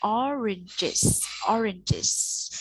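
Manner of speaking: oranges with the ge linked into the final iz; linked plural ending. Shown as a demonstration. Teacher showing her child how to link the ending: oranges joined into iz. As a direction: model oranges slowly, joining ge to iz. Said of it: "từ G này mình đọc là Ch".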